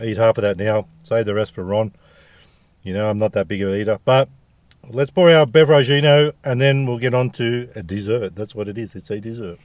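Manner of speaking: normal talking voice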